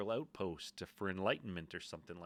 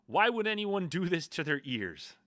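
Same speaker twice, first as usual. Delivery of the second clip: mock exasperation; 9 semitones higher, 8 dB louder than usual. Acts out a hostile comment.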